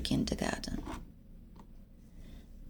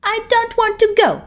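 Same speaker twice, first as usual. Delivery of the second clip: put-on high, tearful little-girl no; a scared child refusing firmly, close to crying; firm refusal; 11 semitones higher than usual. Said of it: "firmly said".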